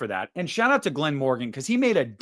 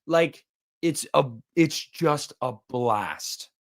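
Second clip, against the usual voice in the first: ordinary talk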